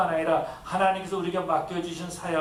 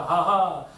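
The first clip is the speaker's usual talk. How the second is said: loud scornful ha-ha; a jeering laugh at the crucified man; mocking laugh; 2 semitones higher, 7 dB louder, much slower than usual. Passersby mocking Jesus on cross.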